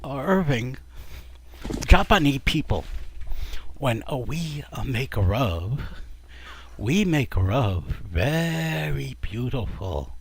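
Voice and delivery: put-on slow, measured Japanese accent. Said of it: "Yakamura finally says to Irving".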